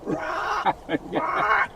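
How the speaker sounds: high squawking rock rock rock; choked hen imitation. Hunter imitating a choked mother hen chicken for buddies, high squawk. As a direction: mimic a hen being choked. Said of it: "sounded like a damn mother hen chicken that was being choked".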